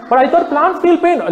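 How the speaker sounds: high, loud sarcastic whine; mocking whine. Speaker mimicking a meat eater's excuse, high sarcastic whine. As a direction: sneer it out in a high voice.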